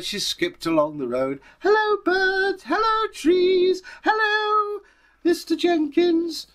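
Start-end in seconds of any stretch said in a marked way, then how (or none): 1.6-6.5 put-on very high, loud, slow girlish greetings; chirpy child calling out hello to everything; little girl.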